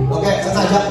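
ordinary talk, nothing marked

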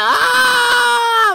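high, piercing cry of alarm; sudden real shriek; sharp cry. Real, not acted. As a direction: yelp like you just got hit. Panic as tower gets hit.

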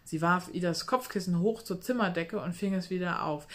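ordinary delivery

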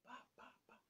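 unvoiced pá pá pá like footsteps; whispered footsteps. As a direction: tap out the steps in a breath. Peito vem andando. (passos imitados, sussurrados)

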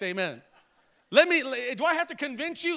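ordinary talk, nothing marked

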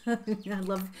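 laughing. Real, not acted. Reader thanking viewers for their support, laughing. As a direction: let a real laugh spill into the words.

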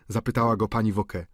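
usual speaking voice

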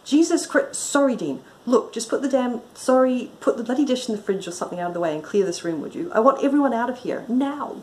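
acted snappy command with hurried apologies; exasperated outburst. A narrator's reading. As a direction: lose patience and take charge. Acted outburst, detective loses patience.

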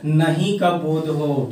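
ordinary talk

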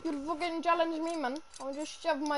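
mock Indian accent. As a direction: put on an indignant Indian accent. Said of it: "my Indian voice".

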